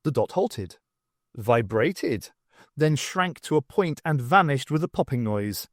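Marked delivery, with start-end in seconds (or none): none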